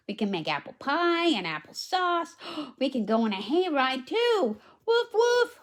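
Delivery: put-on bright, high read-aloud voice for the little girl; high storybook girl. Reads girl's lines.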